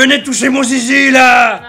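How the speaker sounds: loud, high call to come over; hammed-up yell summoning everyone; mock beckoning shout.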